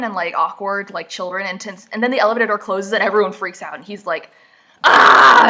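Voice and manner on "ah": very loud, high wild cry; mock lunging yell. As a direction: yell like someone pouncing, hammed-up. Imitating his lunge in the elevator.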